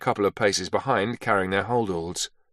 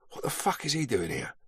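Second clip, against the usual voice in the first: muttered under breath; 9 dB quieter than usual. A narrator's reading. Beach muttering to Carter about Smallwood, under his breath. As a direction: mutter it so only your mate hears. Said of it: "Beach muttered under his breath".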